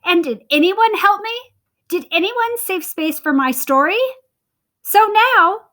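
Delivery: little red hen furious at being ignored, high and loud; indignant hen. Acted. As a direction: pile up the grievances. Hen demands help.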